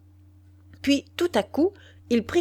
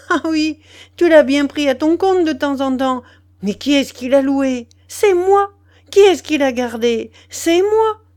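loud, high-pitched furious tirade; enraged outburst at raised pitch; bursting with fury; 5 semitones higher, 7 dB louder than usual. A narrator's reading. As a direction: explode and hurl one accusation after another. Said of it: "elle éclata".

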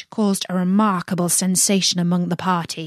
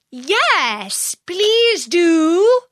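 chorus plea; 11 semitones higher, 5 dB louder, much slower than usual. A narrator's reading. Others join in chorus.

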